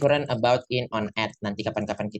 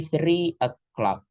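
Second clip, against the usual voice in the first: three o'clock said slowly, each word drawn out; slow teaching demo; 6 semitones higher, much slower than usual. Shown as a demonstration. Teacher modeling the time for students, slow and clear. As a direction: spell out "three o'clock" for the class at half speed. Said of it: "kita ambil contoh".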